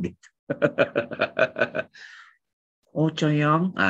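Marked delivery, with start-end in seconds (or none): 3.0-3.8 sayang sung out higher with a lilting rise; affectionate rising sayang; tender sayang. Shown as a demonstration. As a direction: call sayang like a lover, with melody. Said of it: "Ada intonasinya itu".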